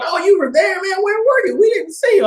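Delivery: very high, incredulous mocking chorus; mock disbelief. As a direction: squeal it like doubtful classmates. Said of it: "And they all".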